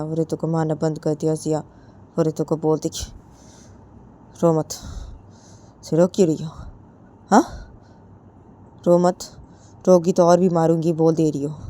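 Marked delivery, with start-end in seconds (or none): 4.4-11.6 put-on louder, scolding a crying girl; bullying older girl, louder; angry scolding. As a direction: snap at her to stop crying. Jiya scolds crying Ritu.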